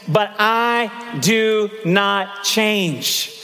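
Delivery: but I do not change, stretched out very slowly; solemn drawn-out proclamation; slow emphatic refrain. Acted. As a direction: let every word of I do not change land like a verdict. Preacher repeats God's refrain.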